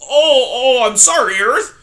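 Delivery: high, loud, sniveling fox. Acted. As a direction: grovel to the porcupine. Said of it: "in a voice that was, at best, sniveling".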